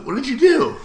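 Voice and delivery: pushing the roommate to confess, high and loud; shocked demand. Retold or imitated. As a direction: press him in disbelief. Demanding what friend did.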